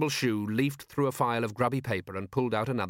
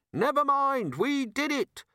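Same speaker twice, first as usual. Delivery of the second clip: high-pitched calling voice; desperate call; slower than usual. A narrator's reading. Trapped robber confessing to anything, calling out desperately.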